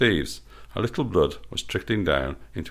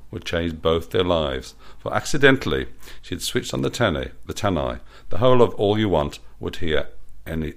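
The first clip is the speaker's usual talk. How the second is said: usual speaking voice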